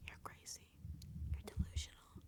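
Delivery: whispered verdict, very soft; imitated silent judgment in a whisper; secret thought. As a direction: whisper it like a thought kept inside. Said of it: "Inside their head".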